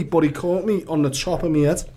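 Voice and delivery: normal talking voice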